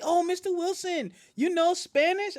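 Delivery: high-pitched imitation of a pupil; excited schoolkid. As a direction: imitate a kid eagerly asking the teacher. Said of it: "The kids I teach do that".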